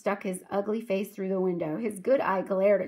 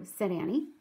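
usual speaking voice

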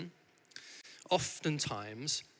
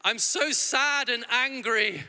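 put-on high, slow, sing-song little girl's lament; mock a child's melodramatic cry; hammed-up little girl, sad and angry; child's dramatic wail; 9 semitones higher, 4 dB louder, slower than usual. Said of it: "she just shouted".

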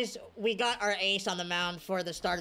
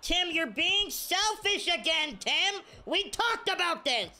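high, exasperated mock telling-off; mock scolding; 8 semitones higher than usual. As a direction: whine at a selfish teammate, playfully. Mock scolding of his player.

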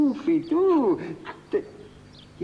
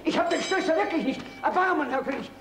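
pleading; 6 semitones higher than usual. Acted. Peasant begs for mercy.

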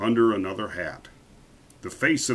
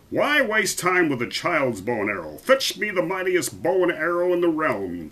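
raised, imperious royal order; commanding king; 6 semitones higher than usual. A narrator's reading. King sending for the mightiest bow, commanding. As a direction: demand it as an exasperated king.

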